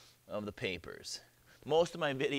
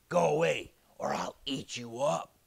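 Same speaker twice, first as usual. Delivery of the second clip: throaty beast voice, louder at first; threatening dragon; 3 dB louder than usual. Acted. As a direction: warn off a rival as a dragon. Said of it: "That's the real dragon talking".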